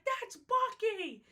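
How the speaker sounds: put-on high, slow, stretched kid's cry of delight; excited kid. A kid spotting Bucky, shouting high and drawn out. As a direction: squeal it like a thrilled child. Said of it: "He's like".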